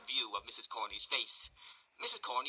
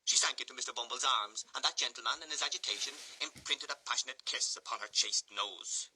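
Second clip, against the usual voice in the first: plain reading voice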